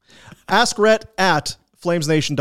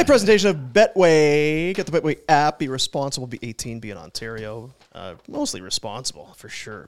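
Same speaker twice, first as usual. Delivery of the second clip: quick radio ad read; 5 semitones lower than usual. Acted. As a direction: rattle off the betting disclaimer. Reads sponsor disclaimer.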